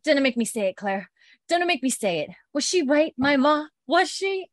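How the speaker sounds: high, rising mock distress; fearful accusation. Marcelly suspecting Claire of witchcraft, mock fearful pleading.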